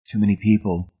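plain reading voice

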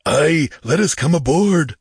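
loud, high demand; clamor to be let aboard; clamoring pirate. A narrator's reading. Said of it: "clamored a lean Shemite".

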